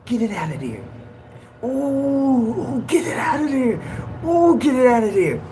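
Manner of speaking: high, pained moaning between the words; anguished cries.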